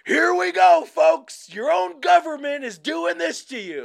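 high, loud radio rant; shouting. Retold or imitated. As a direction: yell it like a frantic radio host. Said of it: "he's like".

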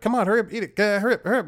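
high, loud urging at the cat; shouting at the cat to hurry up; urgent yelling. Retold or imitated. Urging pet while stuck on bike.

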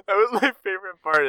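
high laughing burst, words rushed out through the laugh. Real, not acted. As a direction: laugh your way through it fast. Laughing while speaking.

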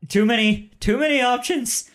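high, loud rising panic; acted freak-out, pitch climbing; panicking. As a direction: cry out as if overwhelmed. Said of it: "he starts, uh, panicking".

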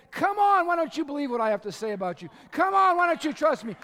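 loud, high urging plea; God calling you to trust him; loud exasperated appeal; pleading God. Retold or imitated. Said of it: "and God's like".

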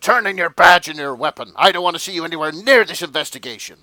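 loud, fast, barking police chief like Jonah Jameson; gruff chief yelling at a rogue detective; shouty police chief. Shown as a demonstration. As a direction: bark orders like an angry newspaper boss. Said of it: "all police chiefs sound like that".

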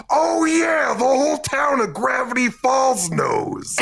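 put-on high, shouting old uncle voice; crackly old great-uncle yelling proudly; Grunkle Stan. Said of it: "three points for the voice alone".

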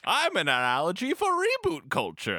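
high, slow, grandiose rich guy; Bruce Wayne voice. Retold or imitated. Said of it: "Bruce Wayne is like".

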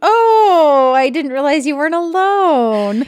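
high, loud fake-innocent gasp; mock surprised rival. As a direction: play her as falsely surprised. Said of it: "And then Balja's like".

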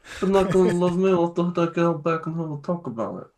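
lightly voiced Godfather-style Italian; Italian mobster accent. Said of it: "Spot on Italian".

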